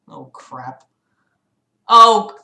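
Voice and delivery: ordinary delivery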